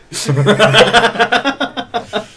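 high-pitched laughing fit; loud high laugh. Real, not acted. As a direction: burst out cackling. Big laugh.

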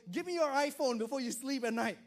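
stern parent. Retold or imitated. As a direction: lay down the rule to your kid. Parent voiced demanding the phone.

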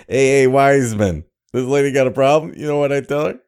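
brash loud wiseguy; fast cocky tough guy. Acted. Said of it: "my brother, the wise guy of the sea".

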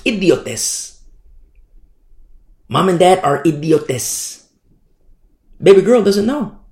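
usual speaking voice

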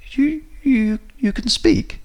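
very high, stammering astonishment; utter disbelief. A narrator's reading. Narrator to a talking loo roll, high-pitched disbelief. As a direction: squeak it out in stunned disbelief. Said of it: "in utter disbelief".

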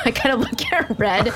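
giggling through a corrected name; laughing. Real, not acted. Player laughing at her own name mix-up, giggling. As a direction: talk while laughing at a slip.

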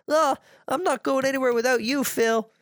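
high-pitched cartoon toddler voice, imitated; mock little-girl protest. Lil refusing to leave left-out Phil, mock high protest. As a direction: squeal it like a loyal cartoon tot.

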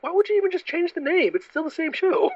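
high-pitched exasperated questioning; incredulous. Acted. Mock disbelief at renaming a show.

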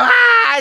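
high, loud mock scream; child's shriek. Imitating daughter snatching her doll away, shrieking. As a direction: yelp in a child's high alarm. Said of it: "She'd go".

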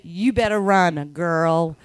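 low, slow, drawled mimicry; American woman voice. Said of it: "Just said".

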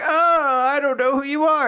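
high, loud put-on wail; mock panicked denial. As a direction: wail it out as a hammed-up old character. Acts Old Weiss denying recognition.

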